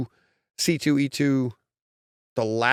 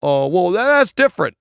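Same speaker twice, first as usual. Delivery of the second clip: mock protest; 4 semitones higher, 5 dB louder than usual. Acts out objector defending mom's accent.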